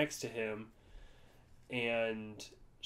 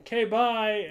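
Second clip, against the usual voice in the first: high, loud, imitated oblivious goodbye; breezy brush-off; 9 semitones higher, 8 dB louder than usual. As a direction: mimic a friend cheerfully bailing on a flirt. Imitates friend's oblivious abrupt goodbye.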